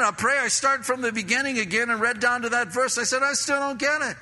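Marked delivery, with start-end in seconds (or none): 3.2-4.2 frustrated. Retold or imitated. Retold frustrated prayer.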